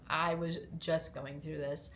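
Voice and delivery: ordinary talk, nothing marked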